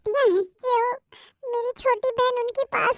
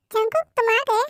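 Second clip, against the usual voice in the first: ordinary delivery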